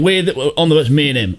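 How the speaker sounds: ordinary talk, nothing marked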